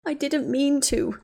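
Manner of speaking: slow, drawn-out, teary plea; slow tearful sob. A narrator's reading. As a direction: let it out slowly through tears. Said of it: "Ginny sobbed".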